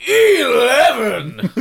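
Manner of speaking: high, slow, villainous drawl; Oogie Boogie voice. Retold or imitated. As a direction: stretch the word like a cartoon villain. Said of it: "He loves to say".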